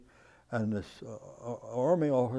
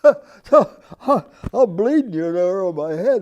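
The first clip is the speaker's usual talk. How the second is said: laughing; 7 semitones higher, 16 dB louder than usual. Real, not acted. Still laughing after the chief's quote.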